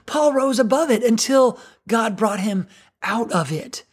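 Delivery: normal talking voice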